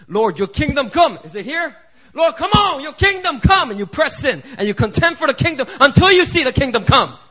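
high, loud, building cry of prayer; rising, fervent pulpit shout; full-force preaching. Acted. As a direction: preach it like you're storming heaven. Preaching climax on contending prayer.

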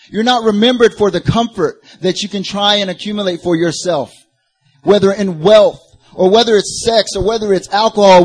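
high, loud sermon delivery; forceful preaching. Real, not acted. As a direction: drive each point home to the congregation.

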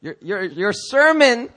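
slow, loud accusing jab; accusatory. Acted. Devil attacking the pastor's sermon, accusatory. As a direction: denounce it, leaning hard on the words. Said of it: "it's accusatory".

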